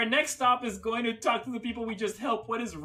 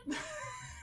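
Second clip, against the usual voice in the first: shrieking laugh; 14 dB quieter than usual. Real, not acted.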